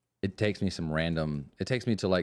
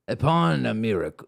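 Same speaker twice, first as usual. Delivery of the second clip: pirate voice; 4 semitones higher, 5 dB louder than usual. Acted. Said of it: "go into some kind of pirate voice".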